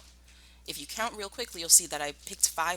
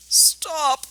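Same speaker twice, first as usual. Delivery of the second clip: much higher, loud plea to stop; pained cry; 11 semitones higher, 10 dB louder than usual. Acted. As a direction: cry out as if the teasing hurts. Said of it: "cried Badger".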